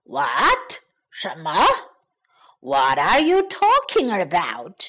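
low-pitched wolf in surprised questioning; taken aback. A narrator's reading. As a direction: ask in low-voiced disbelief. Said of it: "感到非常意外".

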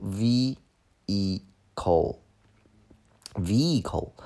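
vehicle very slowly as "VEE-i-cle"; silent H. Shown as a demonstration. Teacher teaching the unpronounced H, vehicle drawn out as "vee-i-cle". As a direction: stretch vehicle into separate syllables, no H sound. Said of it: "H在英文当中通常情况下都是不发音的".

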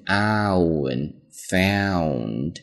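"found" very slow, stretching the "ow" glide; drawn-out diphthong. Shown as a demonstration. Teacher demonstrating the diphthong, drawn out slowly. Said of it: "它中间是一个双元音".

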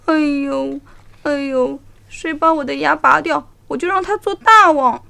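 moaning tiger in pain. A narrator's reading. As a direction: groan through the pain. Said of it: "痛得他直叫".

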